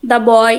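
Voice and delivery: put-on "The boy" with The said "da". Shown as a demonstration. Teacher showing the before a consonant, said as da. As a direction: say "The" in "The boy" as da, not dee. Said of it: "তখন সেটাকে আমরা দা বলবো".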